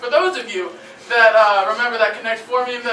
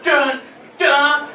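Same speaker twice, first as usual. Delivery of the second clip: dramatic dun dun; 3 semitones higher than usual. Pretend. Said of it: "jumps down from the stairs and goes".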